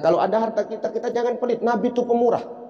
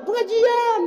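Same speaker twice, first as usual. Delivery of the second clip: much higher, dainty lady voice; high, prissy one-word answer; mock prim lady; slower than usual. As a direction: play the showy lady going to class.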